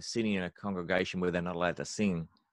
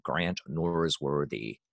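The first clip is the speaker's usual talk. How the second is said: American accent; 5 semitones lower, much slower than usual. Shown as a demonstration.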